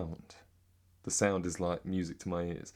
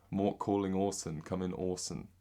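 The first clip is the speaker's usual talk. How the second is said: British accent, r's dropped. Shown as a demonstration. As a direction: say it as a Brit, no r's. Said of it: "That's British".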